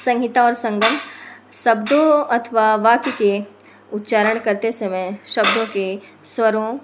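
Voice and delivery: usual speaking voice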